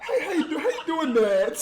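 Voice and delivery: very high, laughing falsetto hello; squeaky greeting. Acted. Greeting Nat in a silly high falsetto voice.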